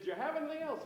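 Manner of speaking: high mock-American tourist; American accent. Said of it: "that's my American accent".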